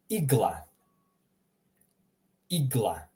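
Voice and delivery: "Igla" as EEG-lah; Croatian I. Shown as a demonstration. Teacher gives learners an example, "Igla" as EEG-lah. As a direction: start "Igla" with an ee sound. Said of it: "sounds a lot more like the English E".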